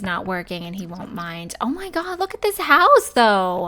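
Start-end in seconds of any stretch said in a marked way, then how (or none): 1.6-3.7 higher, louder real excitement over a house; amazed. Real, not acted. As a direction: squeal at the dream house. Seeing a surprising new house.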